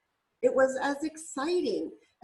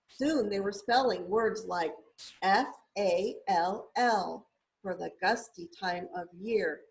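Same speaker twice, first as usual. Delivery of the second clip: plain reading voice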